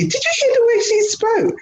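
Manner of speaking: very high-pitched incredulous squeak; astonished line manager. Retold or imitated. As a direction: imitate a scandalised boss, voice shooting right up.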